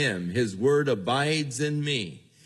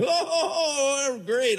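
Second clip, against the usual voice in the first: gleeful high-pitched hoot, oohs drawn out; happy whooping; slower than usual. Acted. Happy borrower going away with the check, whooping with glee. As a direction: whoop it up in a laughing, mock-delighted high voice. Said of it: "you go away just so happy".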